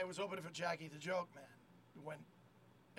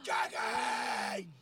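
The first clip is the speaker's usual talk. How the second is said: drawn-out mock crowd yell; imitated crowd shout; 3 semitones higher, 7 dB louder than usual. Crowd calling for the headliner, imitated shout. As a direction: holler it like a waiting audience. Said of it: "you hear the crowd going".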